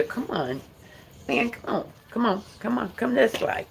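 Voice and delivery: hushed call to the dog; quiet coaxing aside to the pet; soft coaxing. Real, not acted.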